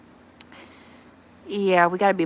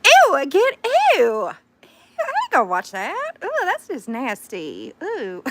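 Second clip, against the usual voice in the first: drawn-out, disgusted Southern reaction; Southern recoil, starting high then settling; Southern accent; 7 semitones higher than usual. Real, not acted. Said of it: "y'all are hearing my Southern accent".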